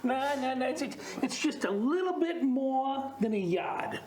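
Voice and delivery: put-on Australian accent for improv; Aussie accent. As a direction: talk like an Aussie bloke. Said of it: "Give us an Aussie accent".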